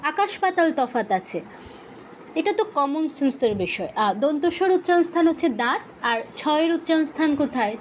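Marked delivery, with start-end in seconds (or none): none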